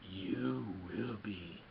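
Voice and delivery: very slow, low, ominous creature voice; creepy Yoda. Retold or imitated. Yoda warning Luke a second time, low creepy drawl. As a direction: stretch every word into an eerie warning. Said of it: "this is kind of a little creepy".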